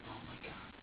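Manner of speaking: barely voiced, retold horror; hushed, breath-only shock; horrified realisation. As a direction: whisper it to yourself, appalled. Retold horror at own violence.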